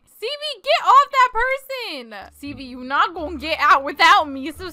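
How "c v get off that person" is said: mock-outraged high call; high-pitched scolding. Player calls pet human off someone, high-pitched scolding. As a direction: order them back in a high, rising-volume voice.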